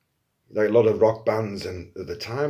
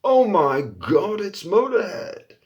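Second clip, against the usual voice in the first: slow, high, louder gasp of delight; starstruck fan; 11 semitones higher, 6 dB louder, slower than usual. Retold or imitated. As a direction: gush like a fan who can't believe it. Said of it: "you're like".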